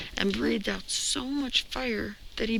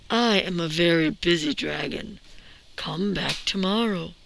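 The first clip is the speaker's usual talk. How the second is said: lazy dragon. Acted. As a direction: boom it out like a bored, self-important dragon. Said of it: "The dragon stuck his nose out of the door and said".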